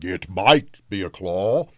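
mournful ghost voice, understated; sorrowful spirit. A narrator's reading. The spirit answers Scrooge, sorrowful. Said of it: "was the spirit's sorrowful reply".

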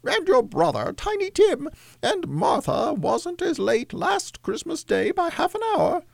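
very high falsetto housewife; mother fussing in a put-on high voice; Cratchit's wife. A narrator's reading. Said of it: "said Missus Cratchit".

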